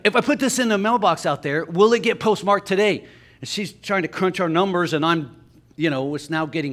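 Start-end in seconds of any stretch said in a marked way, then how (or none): 0.0-3.0 louder, higher imitation of a pushy customer; repeating himself insistently. Customer repeating his question to the postal clerk, loudly insistent imitation. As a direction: say it up and out for someone who missed it. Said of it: "Pardon me? He's like".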